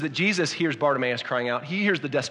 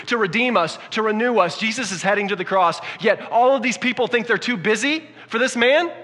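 higher and louder, building urgency; full-force preaching; 6 semitones higher, 5 dB louder than usual. Acted. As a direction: preach it, rising to a peak.

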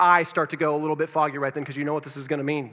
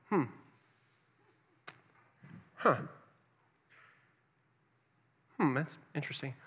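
puzzled banker murmur; 2 semitones lower, 11 dB quieter than usual. Retold or imitated. Banker Lauren quietly puzzling over the account, soft hesitant hums. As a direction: hum and hesitate like a stumped banker.

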